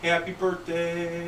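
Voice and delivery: slow, high sing-song "Happy birthday"; punchline greeting. Retold or imitated. Joke teller delivering the partner's punchline, slow and high. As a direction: drag out the punchline sweetly. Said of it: "He turns around and goes".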